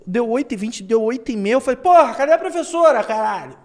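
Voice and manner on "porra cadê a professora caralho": impatient. Retold or imitated. Impatient class leader complaining.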